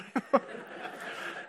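airy unvoiced giggle; breathless high laugh. Real, not acted. Laughing at the uproar he caused, airy. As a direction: let a silent laugh escape.

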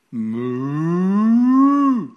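drawn-out rising cow moo. Acted. King eats grass like cow.

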